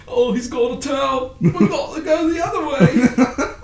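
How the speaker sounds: very high, loud character voice; quoted movie character. Acted. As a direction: squeal it like a hammed-up film quote. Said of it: "just quoting random parts of the movie".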